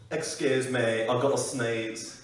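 mock very British gentleman, drawn out at the end; British accent. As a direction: put on an over-polite British accent. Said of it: "Really, really British".

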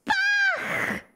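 a fan spotting her idol; excited shout. Real, not acted. Thrilled to get the Bach card.

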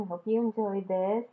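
usual speaking voice

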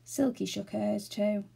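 ordinary delivery

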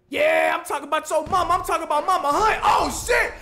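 high, loud mocking taunt. Boxer insulting rival's mother, loud taunt. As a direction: shout the insult at him. Taunting about his mama.

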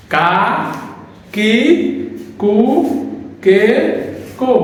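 কা কি কু কে কো said slowly one by one; careful drill; syllable demo. Shown as a demonstration. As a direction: spell out each ka-row syllable. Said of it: "প্রথমে".

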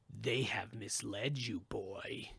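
a heavy, slow evil pull; ancient evil voice. Acted. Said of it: "it said".

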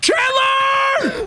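panicked scream. Real, not acted. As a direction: scream for help as you're caught. Attacked by the spider.

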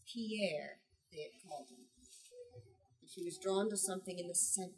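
normal talking voice

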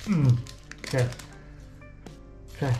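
ordinary talk

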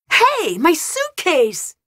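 high, drawn-out cry of protest; alarmed call-out. Acted. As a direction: call out in alarm, pitch leaping up. Calling out after a taken suitcase.

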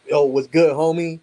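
loud, high, hyped-up "yo, what's good" to a homie; a hammed-up dap greeting to a client; street greeting. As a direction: greet him like an old friend on the block. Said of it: "I need them to see I dap".

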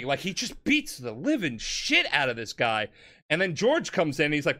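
usual speaking voice

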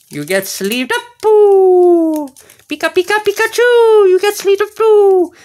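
high, loud squeaky cartoon critter voice; Pikachu voice. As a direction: talk like Pikachu chirping at the card. Keeps Pikachu voice to card.

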